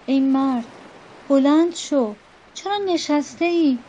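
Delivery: pleading. A narrator's reading. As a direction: beg him to get up.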